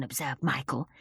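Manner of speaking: usual speaking voice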